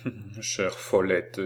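put-on slow, softly touched old man; moved stammer. A narrator's reading. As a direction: mumble it fondly, moved by the embrace. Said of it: "balbutia le vieillard, ému par cette caresse". (vieillard ému qui balbutie)

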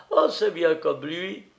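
high voice straight out of a giggle; amused, high-pitched teasing; laughing cook. A narrator's reading. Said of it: "La rieuse cuisinière".

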